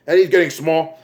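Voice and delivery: ordinary talk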